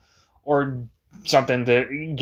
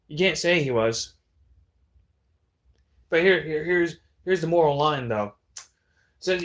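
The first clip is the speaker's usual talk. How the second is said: ordinary talk, nothing marked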